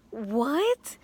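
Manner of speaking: hammed-up disbelief, rising sharply; incredulous reaction. Reacting to a punch from stranger.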